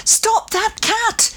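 high, drawn-out urgent call. A narrator's reading. Child calls to people near the dock edge, calling out. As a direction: call slowly across a distance, pitched high. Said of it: "I called out to a group of people".

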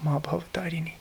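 whispered reverently; hushed name. Retold or imitated. Speaker echoing the sacred name heard on tape, whispered. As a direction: breathe the name softly like a prayer.

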